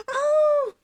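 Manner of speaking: loud high oh, then slow giddy words; squeal, then draw it out; excited squeal. Real, not acted. Reacting to her new hairstyle, giddy squeal.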